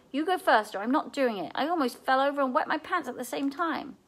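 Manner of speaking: high, rattled, insisting; shaken protest. A narrator's reading. Seth to Kendra after nearly falling, rattled. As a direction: still shaking from the scare.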